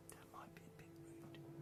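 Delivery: hushed whisper. Real, not acted. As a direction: whisper it under your breath. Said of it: "whispered aside".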